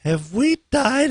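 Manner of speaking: high, slow, wide-eyed "Have we died?"; mock spooked. Host retelling his arrival at the empty station, mock spooked. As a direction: play it spooked, half-joking.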